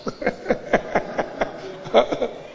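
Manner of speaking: airy unvoiced chuckle; breathy laugh. Real, not acted. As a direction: laugh at your own joke. Congregation joke, preacher laughs.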